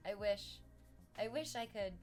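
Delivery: usual speaking voice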